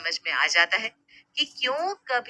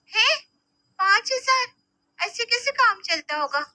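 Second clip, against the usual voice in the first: much higher, shocked disbelief; can't believe the number; 13 semitones higher than usual. Acted. Sister shocked at the amount. (हैरानी से ऊँची आवाज़)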